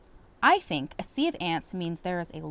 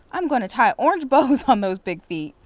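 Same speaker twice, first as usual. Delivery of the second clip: laughing call; 4 semitones higher, 9 dB louder than usual. Acted. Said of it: "she called out".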